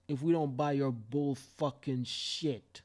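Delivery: ordinary talk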